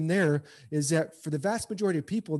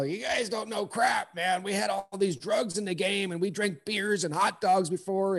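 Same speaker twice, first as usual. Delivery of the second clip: put-on grumpy old-timer; 4 semitones higher than usual. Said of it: "like, the old timers".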